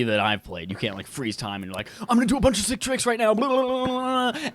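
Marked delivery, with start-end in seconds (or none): 2.0-4.5 hyped, fast and high; mock hype mid-trick; excited. Imitated gamer hyped mid-air.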